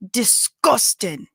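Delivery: "disgusting" drawn out slowly, imitating a Scottish accent. Telling Scottish viewers how they say it, Scottish accent. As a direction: stretch "disgusting" like a Scot would. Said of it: "people say it like that".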